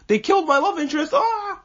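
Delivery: mock a self-pitying teen hero; high, fast mock whine ending in a yelp; whiny Shinji. Mocking Shinji's whiny complaint.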